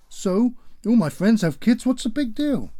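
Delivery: high, loud and dismissive; defiant teen shout. Acted. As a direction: shrug it off loudly. Defiant pregnant daughter.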